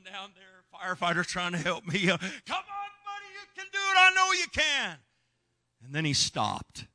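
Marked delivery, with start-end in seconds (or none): 2.1-5.0 cheering on. Retold or imitated. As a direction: cheer him on like a squad. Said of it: "like a cheerleading squad".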